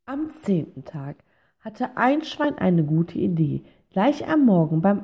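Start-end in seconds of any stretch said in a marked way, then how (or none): none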